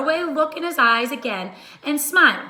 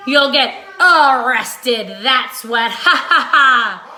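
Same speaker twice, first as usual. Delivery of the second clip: loud, slow jeer ending in a laugh; a booming taunt with a laugh; gloating shout; 9 dB louder than usual. Acted. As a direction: crow over the caught thieves, laughing. Mister Dart gloating at thieves.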